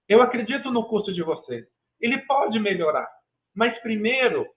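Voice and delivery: usual speaking voice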